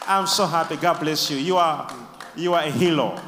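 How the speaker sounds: normal talking voice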